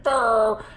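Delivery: sudden loud high "Brr!" scare noise; jump-scare growl. Retold or imitated. Chucky scaring the boyfriend at the fridge, loud high growl. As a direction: bark it out like a doll jumping out. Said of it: "he's like".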